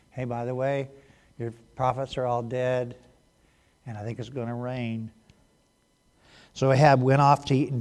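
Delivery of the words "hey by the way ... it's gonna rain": casual mocking. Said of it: "he's mocking him, going".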